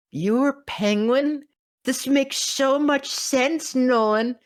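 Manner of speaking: high, slow, amazed squeal; starstruck disbelief. Retold or imitated. To Nolan on learning he was Penguin, high slow amazed. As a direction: gush at the veteran like a fan. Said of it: "I'm like".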